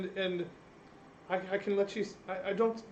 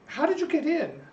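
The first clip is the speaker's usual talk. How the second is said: higher, startled question; 13 semitones higher than usual. Acted. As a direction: blurt it out alarmed. Servant suddenly alarmed.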